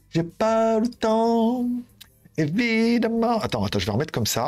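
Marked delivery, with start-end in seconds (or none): 0.1-3.4 slow sing-song drawl. Shown as a demonstration. Vlogger teasing his viewers about his busy schedule, slow playful drawl. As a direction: stretch it out slowly, up high and playful.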